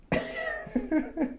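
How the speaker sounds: high burst of laughter at his own joke; embarrassed laugh at his own pun. Real, not acted.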